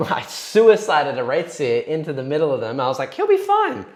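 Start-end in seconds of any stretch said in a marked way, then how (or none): 3.1-3.8 high, squeaky voice quoting yourself; very high-pitched, airy self-mocking quote; mock breezy confidence. Player mocking his own plan after suiciding his Wraithseer, mock breezy confidence.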